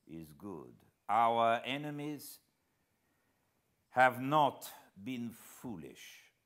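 slow, measured, deliberate words; very slowly. Acted. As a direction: weigh each word like a wise old Frenchman. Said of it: "the Frenchman spoke, very slowly".

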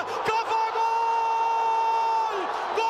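very high stretched goal scream; screaming football commentator. Real, not acted. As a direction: hold the goal call long. Goal call in live commentary.

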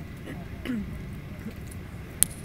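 quiet throat clearing from a frog in throat. Real, not acted. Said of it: "eu tô com um pigarro na garganta".

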